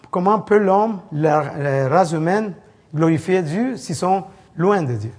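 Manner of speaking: ordinary talk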